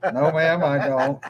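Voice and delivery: laughing sheepish reply. Retold or imitated. Sheepish answer while laughing.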